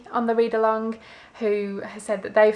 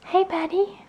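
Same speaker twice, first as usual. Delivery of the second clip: pet talk; 10 semitones higher than usual. Real, not acted. Greeting the sneezing pet, high doting pet voice. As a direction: coo up high at the little dog.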